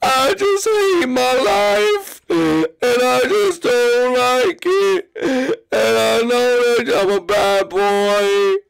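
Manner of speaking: slow, high, whiny self-pity; mock whimper. Iggy sulking to his brothers that he's a bad boy, slow and whiny. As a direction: drag out each word like a sulking child. Iggy's mock sad confession.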